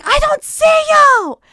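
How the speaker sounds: loud, slow furious yell; angry shout. Retold or imitated. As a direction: bellow it like an angry date. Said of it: "He was like".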